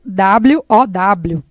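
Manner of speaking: each letter W O W spaced and very slow; spelled out slowly. Shown as a demonstration. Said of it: "É dessa forma aí". (soletrado bem devagar)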